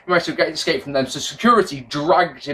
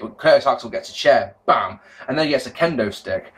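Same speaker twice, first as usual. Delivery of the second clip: ordinary talk, nothing marked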